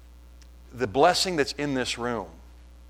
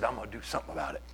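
mumbled stepdad quote; 5 semitones lower, 13 dB quieter, much faster than usual. Retold or imitated. Stepdad quoted in retold talk.

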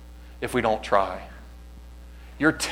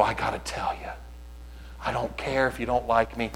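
hushed urging; 8 dB quieter than usual. Acted. Voicing a reluctant urge to share.

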